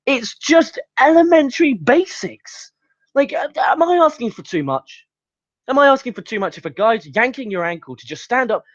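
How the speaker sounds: high, pleading exasperation; exasperated plea. Real, not acted. Reviewer asking wrestlers for elementary basics, exasperated. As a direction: plead as if it were blindingly obvious.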